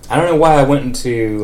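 normal talking voice

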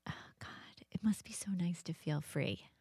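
soft wistful whisper; whispered longing. Retold or imitated. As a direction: breathe it out as a secret wish. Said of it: "and I just thought".

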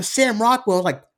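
ordinary talk, nothing marked

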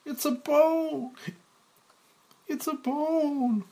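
put-on very high, drawn-out children's chorus; shouting kids. Kids in the audience answer Mike, high drawn-out shouts. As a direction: squeal it slowly like a crowd of kids.

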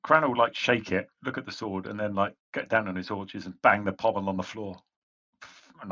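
ordinary talk, nothing marked

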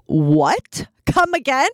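stunned question swooping sharply upward; incredulous double take, acted out; retold shock. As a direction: react in disbelief, pitch leaping up. Retold shock at doctor's news.

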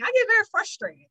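exasperated. Real, not acted. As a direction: let it jump up in exasperation.

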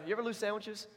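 ordinary delivery

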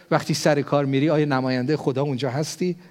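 usual speaking voice

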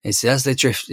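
usual speaking voice

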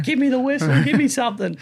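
loud, high pleading yell; high loud begging shout; desperate shout. Retold or imitated. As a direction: yell for the whistle in pain. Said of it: "Yeah, I'm like".

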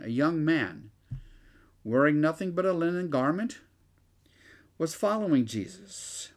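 projected, emphatic Bible reading; scripture reading aloud. Acted. Teacher reads of the young man fleeing, projected scripture reading. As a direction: read the verse out firmly to the room.

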